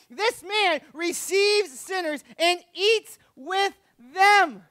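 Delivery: high, loud, drawn-out preaching; preaching at full force. Real, not acted. Preacher proclaiming Jesus' holiness to the congregation, full force.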